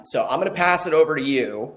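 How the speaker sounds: usual speaking voice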